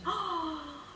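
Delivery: high, drawn-out mock gasp; shocked gasp. Speaker reacting to being called childish, acted shocked gasp. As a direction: gasp sharply as if just insulted.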